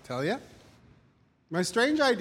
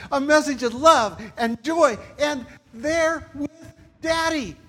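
builds louder and higher, slowing on each phrase; rising preaching; 6 semitones higher, 5 dB louder, slower than usual. Real, not acted. Preacher rallying the church to a fervent peak. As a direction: climb to a fervent slow peak.

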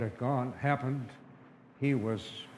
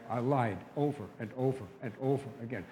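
usual speaking voice

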